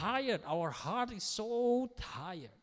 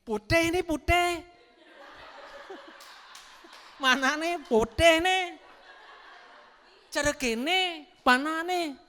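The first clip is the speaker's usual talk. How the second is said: put-on Balinese accent; 7 semitones higher, 10 dB louder than usual. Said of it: "Kalau orang Bali kan ngomongnya gitu".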